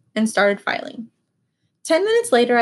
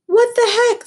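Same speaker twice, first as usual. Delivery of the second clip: high, loud outraged yell; a customer yelling about a broken key; 12 semitones higher, 5 dB louder than usual. Acted. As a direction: shout it in shock. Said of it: "the customer yelled".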